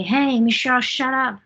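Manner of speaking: mock telling-off with lifted voice; mildly loud retelling. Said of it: "They just say loudly".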